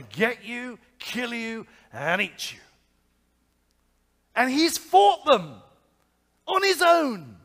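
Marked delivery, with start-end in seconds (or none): 4.3-7.4 loud, slow, high-pitched exclamation; he fought them on his own, shouted; emphatic shout. Real, not acted. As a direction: drive the point home at full voice. Preacher stresses David's courage.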